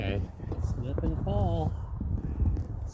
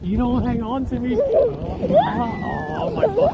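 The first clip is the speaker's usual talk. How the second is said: high excited shrieks; squealing as the sled speeds; sledding downhill; 4 dB louder than usual. Real, not acted. Crashing down the hill.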